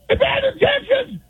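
strained hoarse shouting; hoarse yell. Real, not acted. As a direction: yell through a ruined voice. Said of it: "My voice is hoarse from yelling".

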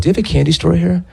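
put-on high child's voice; like a six-year-old. Shown as a demonstration. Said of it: "said it like a six-year-old".

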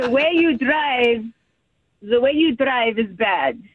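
attempted Egyptian accent, "the" turned toward "zuh". Shown as a demonstration. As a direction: try your parents' thick Egyptian accent. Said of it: "probably the worst Egyptian accent".

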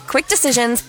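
normal talking voice

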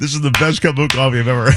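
a lightly voiced rich man delighted; Guccione's verdict. Guccione praising intern's sugary coffee, mock imitation. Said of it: "and he says".

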